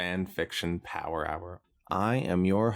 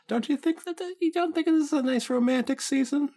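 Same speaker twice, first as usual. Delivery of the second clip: teasing; 3 dB louder, faster than usual. Acted. Teasing host pushes romance.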